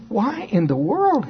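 a playful disbelieving question; mock astonishment. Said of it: "I don't think I said it quite like that".

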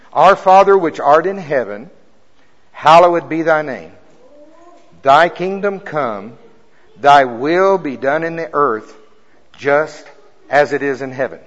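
loud, slow, reverent recitation; solemn prayer recital. Acted. Preacher leads the church in the Lord's Prayer, loud and slow. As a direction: proclaim each line of the prayer, drawn out.

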